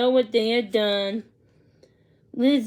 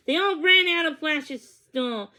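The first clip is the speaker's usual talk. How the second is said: ordinary delivery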